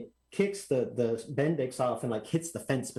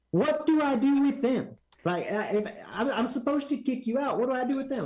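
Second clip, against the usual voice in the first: exasperated scolding; 10 semitones higher than usual. Retold or imitated. Track manager scolding kid racer.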